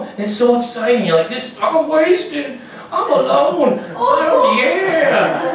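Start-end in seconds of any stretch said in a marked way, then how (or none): 1.3-5.5 high, slow, laughing through it; giggling drunk. Pretend. Drinker alone at home, giggling high.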